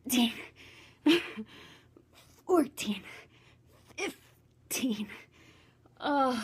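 counting reps while straining; straining through push ups. Real, not acted. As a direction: push out each number through effort. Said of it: "My arms are dying".

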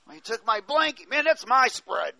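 hammed-up gripe over a stolen blanket; petty grudge voice. Preacher mimicking someone bitter over a blanket, loud mock complaint.

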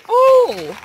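high, loud burst of surprise; surprised oh. Real, not acted. Player spots a gift, loud surprised gasp. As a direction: caught off guard, blurt it out.

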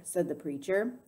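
plain reading voice

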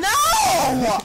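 high, loud cry of protest; anguished shout. Real, not acted. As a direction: yell it in real dismay. Losing item to rival player.

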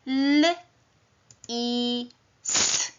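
"L, i, s" as three separate sounds; sounded out phonemes. Shown as a demonstration. Teacher sounding out the fox word for pupils, phoneme by phoneme. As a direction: isolate "L", "i" and a hissed "s" with long pauses. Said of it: "głoskuję ten wyraz".

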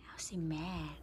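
hushed whisper; breathy aside. Real, not acted. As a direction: whisper so the cat won't hear. Whispering near the angry cat.

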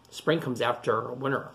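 usual speaking voice

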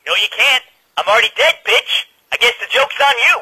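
loud, high, taunting anger; defiant comeback. Acted.

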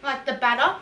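eating a snack while talking; speaking while chewing. Real, not acted. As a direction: mouth partly full. Seen 4: Middle speaker holds bitten food near mouth, eating before and during words.

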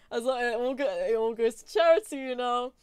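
ordinary talk

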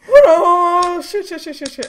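loud, high, crying out in fear; scared cry. Real, not acted. Gamer caught by the monster, frightened cry. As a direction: wail it in real fright.

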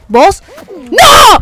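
loud, high scream; shouted outrage. Real, not acted. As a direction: shriek it out. Reacción al caer. (grito agudo de indignación)

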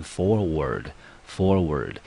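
forward, forward with FOR punched, ward weak; stress on first syllable. Shown as a demonstration. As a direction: say forward twice, hitting FOR. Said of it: "整个重音放在第一个音节". (重读forward第一音节FOR)

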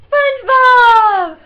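long, high, drawn-out yell; excited shout. Real, not acted. Vlogger suddenly showing off SpongeBob, excited drawn-out shout. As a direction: belt out the name with silly glee.

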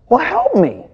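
high-pitched exasperated plea, imitated; really upset. Upset woman finally asking the pastor for help, really upset. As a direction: mimic an upset woman giving in, pitch leaping up. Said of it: "she started getting really upset".

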